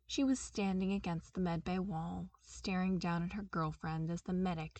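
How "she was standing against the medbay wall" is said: usual speaking voice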